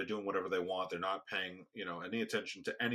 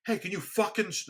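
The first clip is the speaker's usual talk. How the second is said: retold angry outburst, pitched high; losing it; 11 semitones higher, 4 dB louder than usual. Speaker retelling how he snaps after repeated asking, losing it. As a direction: suddenly lose patience, higher and sharper. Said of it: "lose it, you know".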